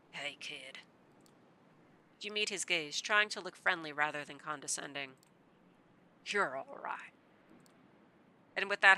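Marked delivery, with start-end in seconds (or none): 0.1-0.8 put-on a much softer old man's voice. Old man murmurs to the kid, softly fond. 6.3-7.1 high, breathy fond whisper; whispered affection. Acted. Old man whispers praise to the kid.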